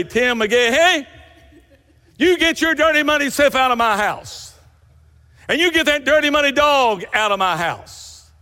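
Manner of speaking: wife yelling at her muddy husband and dog; angry wife's shout. Pretend. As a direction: throw him out of the house, full scold. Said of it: "cry out and say".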